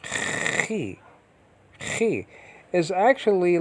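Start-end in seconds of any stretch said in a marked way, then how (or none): 0.0-1.0 Chi with a long throat-scraped ch; guttural ch. Shown as a demonstration. Teacher showing students the Greek letter, guttural. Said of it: "It's an actual guttural". 1.8-2.3 Chi opening on a throaty fricative; guttural ch. Shown as a demonstration. Teacher repeating the Greek letter sound, guttural. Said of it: "It's an actual guttural".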